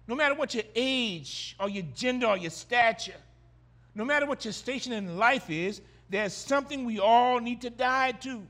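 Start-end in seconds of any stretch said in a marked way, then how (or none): none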